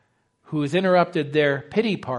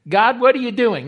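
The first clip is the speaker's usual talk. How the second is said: frustrated cry; 7 semitones higher, 7 dB louder than usual. Retold or imitated. Voicing a frustrated believer.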